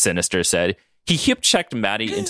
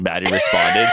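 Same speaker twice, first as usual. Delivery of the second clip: ordinary talk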